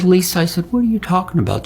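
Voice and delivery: mildly exasperated retort, pitched well up; lightly acted exasperation. Retold exasperated reply to interrogation.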